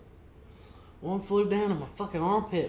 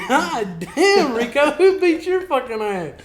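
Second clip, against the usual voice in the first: high, loud, cracking up mid-taunt; laughing taunt; 9 semitones higher, 10 dB louder, slower than usual. Retold or imitated. Teasing a coworker about his swollen lip, laughing loud. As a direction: tease him while you can't stop laughing.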